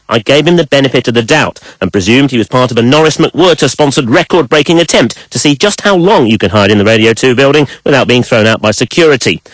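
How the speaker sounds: ordinary delivery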